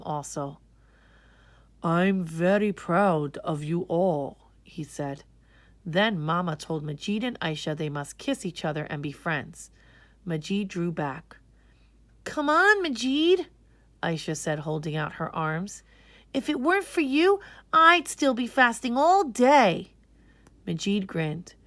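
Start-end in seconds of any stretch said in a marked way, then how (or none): 1.8-4.5 proud grandfather. Acted. Giddu praises children. 12.2-13.5 put-on high, loud coaxing whisper of a girl. Girl coaxes her brother to make friends, high and eager. 16.3-19.9 put-on grateful girl. Aisha thanks brother.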